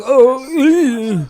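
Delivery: long, high, loud mock squeal; exaggerated high wail of pretend pain. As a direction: imitate a punched man's drawn-out squeal. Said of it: "That was the noise he made".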